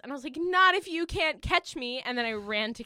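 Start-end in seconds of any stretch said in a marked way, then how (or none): 0.4-2.0 high, slow, raised-voice mock taunt; hammed-up getaway line; playful defiance. Dreamer retells taunting the manager before running off, playfully dramatic.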